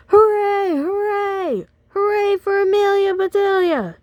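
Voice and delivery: very high, loud crowd cheer; triumphant hooray. Acted. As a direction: roar like a happy team. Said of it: "she heard a loud roar".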